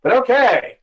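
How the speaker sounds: normal talking voice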